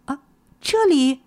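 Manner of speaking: high, astonished question; very surprised. A narrator's reading. Girl reacts to the shabby house, astonished. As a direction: ask it high, taken aback. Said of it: "姑娘惊讶极了".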